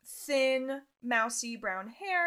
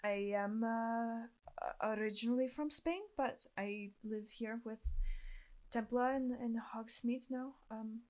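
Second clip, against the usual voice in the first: put-on softly spoken Spanish-accented boy; 4 dB quieter than usual. Arturo from Spain introduces himself to a new acquaintance, soft Spanish accent. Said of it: "That was such a good accent".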